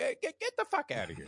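high, mock-disgusted get outta here; exasperated retort. Customer snapping back at a pretentious coffee order, high and scornful. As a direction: scoff it off, voice jumping up high. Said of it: "you're like".

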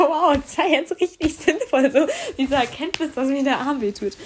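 high, loud mock-impressed retort; exaggerated sarcastic "wow" delivery; sarcastic amazement. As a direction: praise the obvious with heavy sarcasm. Sarcastic retort to obvious diagnosis.

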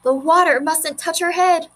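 understated scream of panic; mild narrated scream. A narrator's reading.

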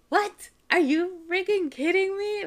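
high, loud, incredulous outburst; mock outrage. Mom finds out her son was pranking her, mock outrage. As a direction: act floored by a prank, pitch shooting up.

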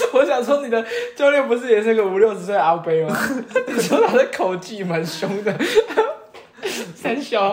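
high, loud, laughing through the words. Real, not acted. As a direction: tease while cracking up. Teasing friend about a dirty joke.

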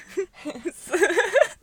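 loud high laugh; high burst of laughter. Real, not acted. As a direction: break into laughter. Laughing about the loud house.

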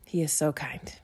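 whispered tenderly; whispered awe. Real, not acted. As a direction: breathe it as a quiet prayer. Tender closing of devotional.

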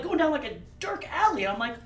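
usual speaking voice

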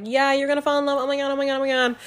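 excited best friend. Acted. Best friend teasing her about falling in love, mock excitement.

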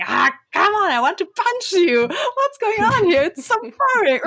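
high, whiny exasperation climbing up; exasperated inner voice. Retold or imitated. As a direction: complain like a bored kid stuck in class.

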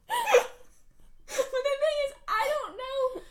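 high, slow laughing disbelief. Real, not acted. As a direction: laugh through the confusion.

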